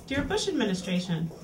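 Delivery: ordinary delivery, nothing marked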